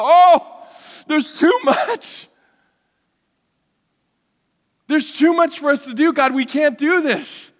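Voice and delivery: high-pitched, hammed-up cry of despair; overwhelmed wail. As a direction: wail to God in mock panic. Acts out his overwhelmed despair.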